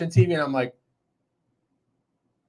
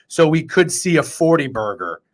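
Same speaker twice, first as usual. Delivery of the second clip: ordinary talk, nothing marked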